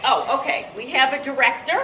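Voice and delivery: ordinary talk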